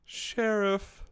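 drawn-out high greeting; hammed-up showdown greeting; mock western standoff. Podcast host greets co-host like a showdown.

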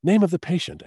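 high, loud mock British actress; imitation British actress teeing up a question; attempted British accent. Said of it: "I can't do a British accent".

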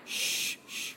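breathy hush to the crowd; quiet them down with a shush. Real, not acted. Preacher hushing the church before the prank call, soft shush.

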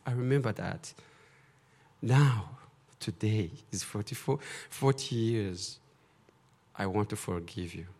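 soft, low, grave reproach; soft, solemn words of forgiveness; solemn forgiving confrontation. Retold or imitated. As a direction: speak it heavy and quiet. Confronting brother, offering forgiveness.

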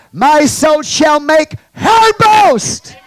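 slow, loud, high shouted quote; shouted scripture. Real, not acted. Preacher shouting David's psalm, slow and loud. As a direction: shout the verse out, dragging it. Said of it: "David said this about her".

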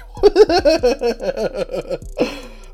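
high-pitched amused laughter. Real, not acted. Laughing at himself for talking like he'll meet her, high giggle. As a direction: laugh at how silly you sound.

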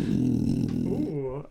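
tiger growl. Pretend. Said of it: "okay, that was a little better".

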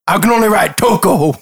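loud proud simple fisherman; Toko voice. Acted. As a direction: boast it loudly, cheerfully.

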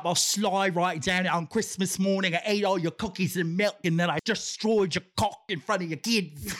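put-on comic slightly Australian accent; put-on accent drifting Australian; a little Australian. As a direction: slide into a mock Australian twang. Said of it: "You went a little Australian in the end".

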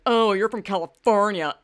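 loud, scornful mimicry of disgusted locals; mocking disdain. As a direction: blurt it out as mock outrage, ending in a disgusted noise. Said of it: "hilarious how people are like".

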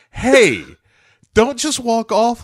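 mock husband calling out in protest; retold indignant protest. Retold husband confronts departing wife.

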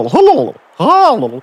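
high, slow drawn-out hello; calling out. Acted. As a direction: call down a dead line. Calling into the phone.

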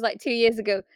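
usual speaking voice

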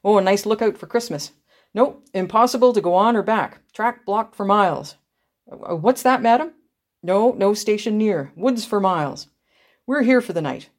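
brisk conductor bearing bad news. A narrator's reading. Conductor telling anxious passengers the train is stuck, brisk and loud. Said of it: "replying briskly".

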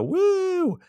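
put-on very high girly cheer; mock hot-tub squeal of "woo"; party woo. Co-host imitating three girls in the hot tub, cheering. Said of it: "they go".

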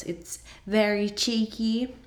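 muddled mock-Australian accent; botched Australian accent. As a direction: have a playful go at an Australian accent and miss. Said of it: "I don't know what accent that was".

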